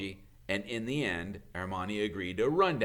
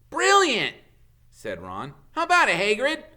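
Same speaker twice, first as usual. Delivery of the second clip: much higher and louder, delighted; thrilled; 12 semitones higher, 10 dB louder than usual. Acted. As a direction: jump on the idea. Ron thrilled by idea.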